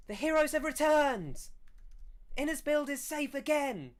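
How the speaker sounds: high, loud proclamation to a crowd; calling out. Acted. Villager announcing the heroes' return to the crowd, triumphant shout. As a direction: shout the good news across the hall, very high. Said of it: "And she calls out".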